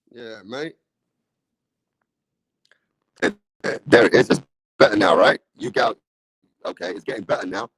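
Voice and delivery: crackly, cutting-out live-stream audio; broken mic. Real, not acted. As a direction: voice breaking up through a shorting mic. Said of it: "I sound like staticky".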